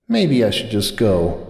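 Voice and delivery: slow, soft, defeated; dejected. A narrator's reading. Sunburst ready to leave, slow and defeated. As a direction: let it fall out tired.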